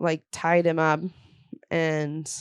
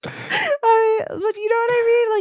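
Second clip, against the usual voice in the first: laughing fit; 3 dB louder than usual. Real, not acted. Laughing hard mid-sentence.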